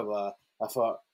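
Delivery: ordinary talk, nothing marked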